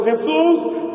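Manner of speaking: high-pitched call for attention; imitated cry. Blind man calling over the crowd to Jesus, high imitated cry. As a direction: cry the name out high, at little extra volume. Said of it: "Gritó y dijo".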